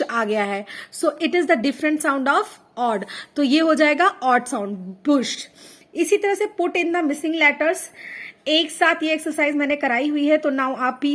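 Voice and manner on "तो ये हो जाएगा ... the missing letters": ordinary talk, nothing marked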